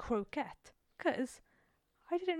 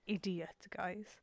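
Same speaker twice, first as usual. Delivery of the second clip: whispered aside; 3 semitones lower, 8 dB quieter, much slower than usual. Real, not acted. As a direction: whisper idiot, guys as a confession. Confiding aside to listeners.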